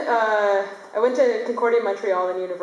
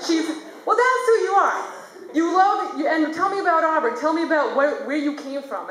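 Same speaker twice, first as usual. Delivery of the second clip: teacher's voice; 5 semitones higher than usual. Retold or imitated. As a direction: press the student like a demanding teacher. Teacher voiced, pressing student.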